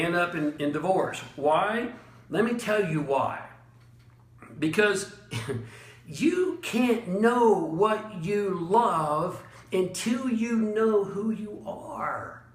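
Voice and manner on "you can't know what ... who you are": very slow with long dramatic pauses; pause for emphasis. Shown as a demonstration. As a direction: stretch it out, pause between words. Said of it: "That's called a pause for emphasis".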